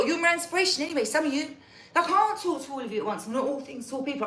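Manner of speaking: ordinary talk, nothing marked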